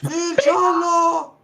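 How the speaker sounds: high drawn-out shout. Pretend. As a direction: call it out high and slow. Shouting colours in Italian.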